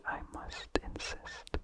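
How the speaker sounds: plain reading voice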